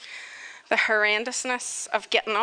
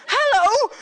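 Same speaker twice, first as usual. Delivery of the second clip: startled; 12 semitones higher, 7 dB louder than usual. Retold or imitated. Hungry camel right beside her.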